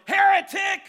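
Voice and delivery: screamed, very high and loud; furious yell. Retold or imitated. Pastor screaming at the falling man, furious yell. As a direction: hurl the word like a curse. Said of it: "the pastor yelled".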